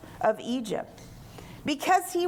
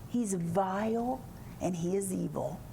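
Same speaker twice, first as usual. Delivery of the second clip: low, soft melodramatic accusation; hammed-up accusing undertone; soap opera drama; 8 semitones lower, 6 dB quieter than usual. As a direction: play it like a hushed soap reveal. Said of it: "you know, a soap opera".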